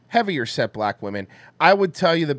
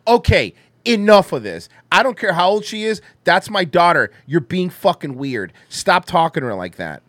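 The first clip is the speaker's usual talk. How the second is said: loud, sharp scolding, louder than her usual talk; angry scolding mother; 4 semitones higher, 6 dB louder than usual. Retold or imitated. Mother scolding a flirting stranger, loud and angry. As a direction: wag a finger and tell him off loudly. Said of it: "so fucking angry".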